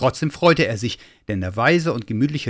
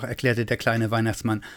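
usual speaking voice

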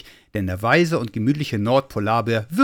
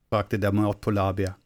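usual speaking voice

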